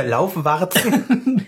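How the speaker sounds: stretched out through a laugh. Real, not acted. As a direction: say it while laughing. Lachend gesagtes Wort. (lachend gedehnt)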